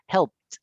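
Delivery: "helped" ending in a crisp T sound; "helped" clipped to a final T. Shown as a demonstration. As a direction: say "helped" as one syllable, helpt. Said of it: "Entonces yo digo".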